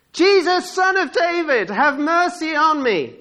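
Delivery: very high, loud calling; full-voiced cry to someone passing. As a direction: shout it out with long, falling line ends. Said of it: "he's just crying out".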